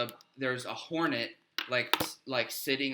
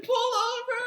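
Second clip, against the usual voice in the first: high, loud panicked yell, half laughing; mock scream; 5 dB louder, slower than usual. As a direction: imitate a passenger screaming to stop. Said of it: "he's screaming".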